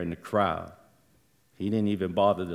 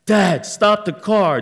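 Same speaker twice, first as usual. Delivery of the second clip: screaming; 11 semitones higher, 9 dB louder, slower than usual. Retold or imitated. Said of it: "I'm screaming".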